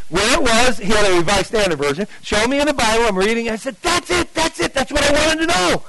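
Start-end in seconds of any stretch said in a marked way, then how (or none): 3.8-5.8 high, eager exclamation; excited recognition. Retold or imitated. Seeker reading the Bible verse, excited recognition. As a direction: cry out high, thrilled to have found it.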